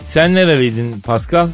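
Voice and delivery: ordinary talk, nothing marked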